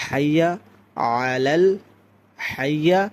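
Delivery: হাইয়া with full h, rising, then আলাল; deliberate model of হাইয়া আলাল; careful articulation. Shown as a demonstration. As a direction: separate হাইয়া and আলাল with pauses. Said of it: "এরপর দেখেন".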